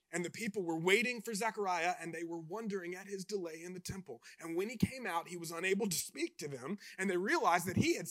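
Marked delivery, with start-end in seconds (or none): none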